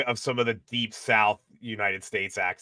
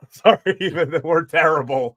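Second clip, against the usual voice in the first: laughing through the apology, louder and higher; 5 semitones higher, 7 dB louder than usual. Real, not acted. Guest apologising for bad subtitles, laughing. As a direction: giggle out the sorry.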